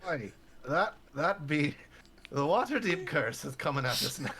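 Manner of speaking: hammed-up pirate brogue. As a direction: bellow it like a rough old sailor. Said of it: "what's going on with your voice".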